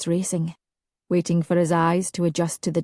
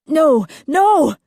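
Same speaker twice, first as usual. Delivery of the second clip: desperate cry; 9 semitones higher, 7 dB louder than usual. A narrator's reading. Alistair protesting in dream.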